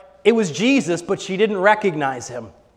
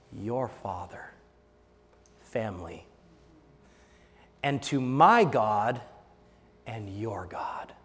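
very soft, slow, near whisper; Jesus tenderly telling Mary of his Father; hushed tender; 10 semitones lower, 12 dB quieter, slower than usual. Retold or imitated. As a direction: let it land gently. Tender revelation.

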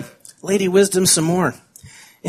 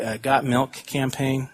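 usual speaking voice

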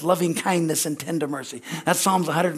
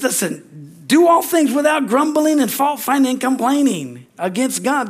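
forceful preaching; 8 semitones higher, 5 dB louder than usual. Acted.

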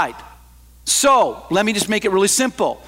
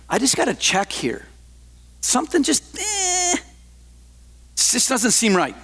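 uneasy misgiving; 4 semitones higher than usual. Acted. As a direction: voice a nagging doubt, squeaking up midway. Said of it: "He goes".

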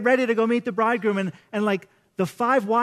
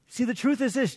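ordinary talk

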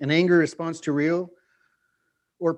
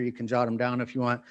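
usual speaking voice

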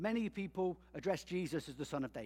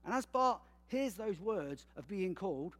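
ordinary talk, nothing marked

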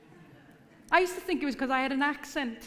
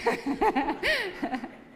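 laughing; 8 semitones higher, 4 dB louder than usual. Real, not acted. Laughs at own joke.

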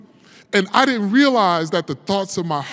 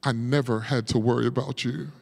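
softer, a mother's calm reassurance; a quieter, gentle mother telling her son he is fine; 7 semitones lower, 10 dB quieter than usual. Retold or imitated. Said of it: "in a very, very calm voice".

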